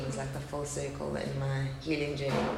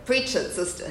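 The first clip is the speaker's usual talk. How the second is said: higher-pitched mock Southern preacher accent; American Southern accent; 6 semitones higher than usual. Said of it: "in his best American Southern accent".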